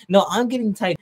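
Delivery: lightly mocking, insistent squabble; mock squabbling. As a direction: playfully mimic rivals fighting over a prize. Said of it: "everyone's just fighting over who's gonna get Titan".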